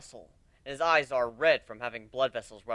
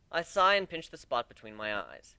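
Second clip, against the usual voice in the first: ordinary delivery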